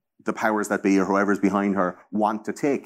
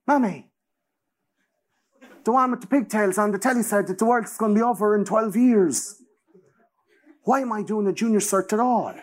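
high, put-on child voice; mock worried kid; 11 semitones higher than usual. A child telling mommy about the end of the world, in a high whine. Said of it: "thirteen-year-olds going".

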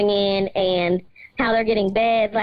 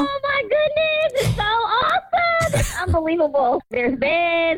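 tearful, high excited voice; choked up with happy disbelief; overjoyed; 9 semitones higher than usual. Real, not acted. Sister overwhelmed by surprise gifts.

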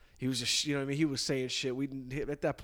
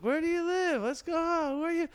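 very high put-on girl voice; mock lost-girl accent, pitched way up; 3 dB louder than usual. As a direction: squeal it like a dazzled girl. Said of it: "Wait, what accent was that?".

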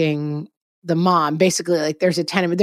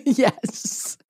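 laughing; 4 semitones higher, much slower than usual. Real, not acted. Laughing while admitting crying more.

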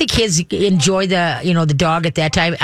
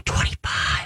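breathy awed whisper of the number; 8 dB quieter, slower than usual. Real, not acted. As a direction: hush it like a secret. Marvelling over a huge clutch.

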